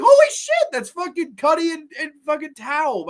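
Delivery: high, loud burst of astonished recognition; marking out. Retold or imitated. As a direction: relive the thrill of spotting familiar actors. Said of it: "I marked out".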